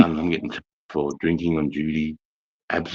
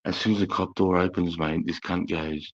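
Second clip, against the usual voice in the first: ordinary talk, nothing marked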